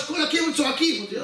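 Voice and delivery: normal talking voice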